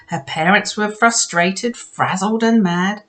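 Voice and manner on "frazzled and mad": ordinary delivery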